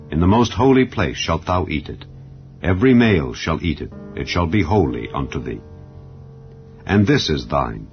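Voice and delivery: normal talking voice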